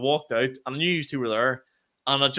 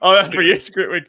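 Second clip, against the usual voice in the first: sarcastic mockery; 5 semitones higher, 10 dB louder than usual. Mocking co-host's boast.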